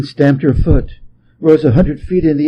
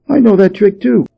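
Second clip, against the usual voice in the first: high, louder monkey call; a bright monkey shout, higher up; cheeky monkey voice; 8 semitones higher, 4 dB louder than usual. A narrator's reading. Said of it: "called Monkey".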